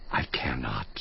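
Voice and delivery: whispered, defeated and spent; broken whisper. Acted. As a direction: let the words die in a breath. Despairing man breaks off, whispering.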